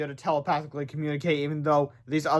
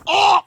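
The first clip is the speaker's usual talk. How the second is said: very high, louder yelp; loud pained cry; 9 dB louder than usual. Acted. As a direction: shriek as if struck. Villain hit in fight.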